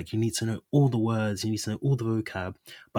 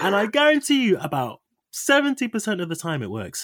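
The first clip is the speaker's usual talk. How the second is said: excited; 10 semitones higher, 4 dB louder than usual. Real, not acted. Amused, excited claim.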